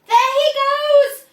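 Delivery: high, loud shout. Acted. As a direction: point and shout to the crowd. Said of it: "somebody shouted".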